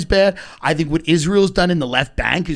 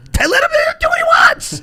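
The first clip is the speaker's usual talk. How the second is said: shrill cracking yell; escalating comedy rant. Acted. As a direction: squeal it out in exasperation. Rant peaks in a yelp.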